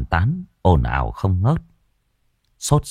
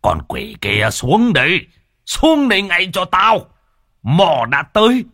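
high, loud commanding shout at the demon; furious shaman's roar; shouting exorcist; 11 semitones higher, 3 dB louder than usual. Acted. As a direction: bark orders to drive the spirit down. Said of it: "quát lên".

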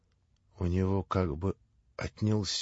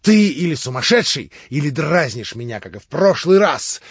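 loud, higher angry shouting; furious yell; 5 semitones higher, 10 dB louder than usual. Acted. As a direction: keep raging at him. Ivan continues yelling.

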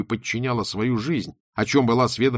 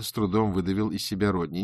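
plain reading voice

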